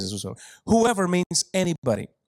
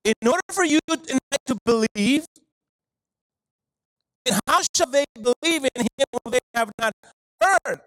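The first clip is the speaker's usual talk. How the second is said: much higher and louder, ringing out; preaching at full force; 8 semitones higher, 3 dB louder than usual. Acted. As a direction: preach it hard, climbing to heard. Preaching with rising fervor.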